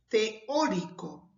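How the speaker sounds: "Teórico" said slowly, stress on "Ó"; slow stress demo. Shown as a demonstration. Teacher shows students where the stress falls, slowly. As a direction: linger on "teÓrico", marking the stressed syllable. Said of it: "lleva tilde por tratarse de una palabra esdrújula".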